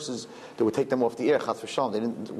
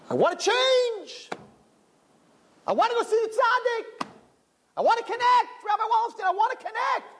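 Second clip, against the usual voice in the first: put-on much higher, pleading voice of a girl longing to change; yearning young seeker. Girl alone in her room begging to see the rabbi, high and pleading. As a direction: beg to change in a high, longing girl's voice, shouting at the end. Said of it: "you're like".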